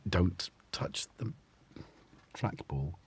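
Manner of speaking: soft whispered don't; whispered warning. Real, not acted. Owner warns the cat off the trackball in a whisper. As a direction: warn the cat under the breath.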